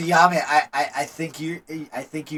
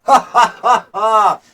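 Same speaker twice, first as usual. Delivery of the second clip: loud, high, slow belly laugh; Ramasundaran's boisterous laugh; 7 semitones higher, 15 dB louder, slower than usual. Acted. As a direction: roar with drawn-out laughter for the whole tavern. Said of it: "you would hear the boisterous laugh of Ramasundaran".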